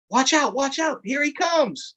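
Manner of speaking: high urgent watch out; alarmed warning. Acted. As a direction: warn the kids as the gator comes. Gator spotted coming.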